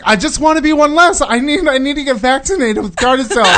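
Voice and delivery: high, loud mock pleading; imitated urgent insistence. Voicing co-host begging for vaccination in the dream, urgently.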